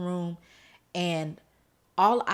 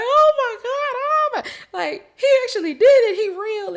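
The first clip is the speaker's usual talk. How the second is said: very high, loud wailing disbelief; crying in awe; 14 dB louder than usual. Retold or imitated. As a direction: reenact an overwhelmed, tearful outcry to God. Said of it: "I'm crying and I'm having this experience".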